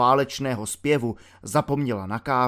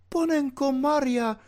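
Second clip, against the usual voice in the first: put-on much higher, shocked old woman's cry; old landlady's cry; 12 semitones higher than usual. A narrator's reading. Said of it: "vykřikla paní Millerová". (vyděšený výkřik staré paní)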